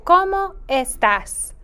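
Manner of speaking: "¿Cómo estás?" in a teacher's deliberate Spanish pronunciation; best Spanish voice. Shown as a demonstration. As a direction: model "¿Cómo estás?" for pupils in careful Spanish. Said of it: "using your best Spanish voice".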